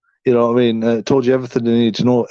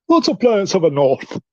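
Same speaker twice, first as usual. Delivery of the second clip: laughing, a put-on northern English accent for "Lots of planets have a north"; playful northern English impression, giggling; mock northern accent; 6 semitones higher than usual. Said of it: "Why do you sound like you're from the north?".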